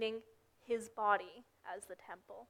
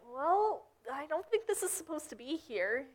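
higher-pitched, mock polite objection; lightly acted protest; mild objection; 5 semitones higher than usual. Jesus to the temple market sellers, mildly objecting.

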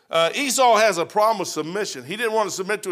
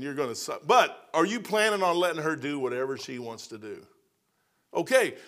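normal talking voice

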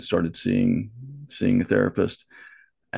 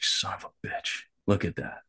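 whispered realization; 7 dB quieter than usual. Retold or imitated. Stunned realization at the voice.

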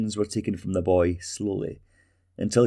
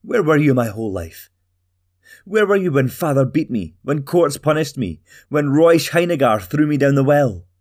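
higher and louder, accusing; angry shout; 6 semitones higher, 6 dB louder than usual. Acted. Talgo shouts accusations at his mother, higher and louder. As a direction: hurl each question at her.

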